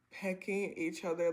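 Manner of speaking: ordinary delivery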